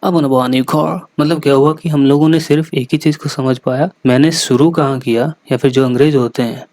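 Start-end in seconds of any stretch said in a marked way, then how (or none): 0.0-1.0 quick British English, only start and end clear; fast clipped British delivery; fast British mumble. Shown as a demonstration. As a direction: rush the middle words like a native Englishman. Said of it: "वो इस तरीके से बोलेंगे".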